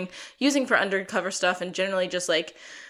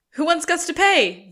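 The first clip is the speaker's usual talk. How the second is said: higher and louder, showman style; rallying call; 9 semitones higher, 8 dB louder than usual. Retold or imitated. Sean calls a vote among the band, higher and louder. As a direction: call out to the room like a host. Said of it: "And then".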